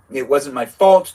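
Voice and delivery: loud, slow protest from a witch; defensive witch excuse. Acted. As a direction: blurt the excuse loudly, slowly.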